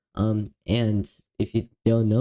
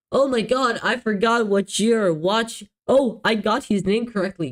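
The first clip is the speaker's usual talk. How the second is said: excited; 7 semitones higher than usual. Real, not acted. Realises he got the name right.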